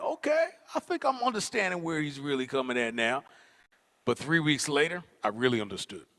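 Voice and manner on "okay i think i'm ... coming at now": dawning understanding. Retold or imitated. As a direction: pitch up the okay, then think it through. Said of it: "and I was like".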